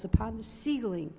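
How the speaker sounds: ordinary delivery, nothing marked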